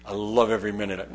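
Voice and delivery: sarcastic fake enthusiasm about body punches; sarcastic mock delight. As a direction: pretend to love the punches, dripping irony. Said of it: "I think to myself".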